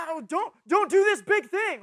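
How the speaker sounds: high, loud exaggerated admonishing; mock alarmed warning. Preacher parodying church talk about big sins, loud mock warning. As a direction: overdo the dire warning.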